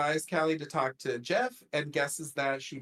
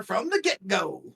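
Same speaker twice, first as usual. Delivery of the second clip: light country twang on "get-go"; imitated country accent. Recapping Shauna's phrase to co-host, lightly country accent. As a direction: quote it with a country lilt. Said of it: "she said it just like that".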